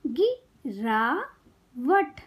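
गिरावट very slowly in three separate parts; syllable by syllable. Shown as a demonstration. Teacher teaching kids to read, syllables apart. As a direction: break गिरावट into गि - रा - वट. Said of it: "शब्द पढ़ना सिखाऊंगी".

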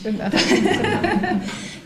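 loud high burst of laughter; big laugh. Real, not acted. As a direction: laugh out loud at your own joke. Laughs at own joke.

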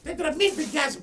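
loud, confrontational demand; angry challenge. Acted. Challenging the one who threatened a shoe beating, angry and loud.

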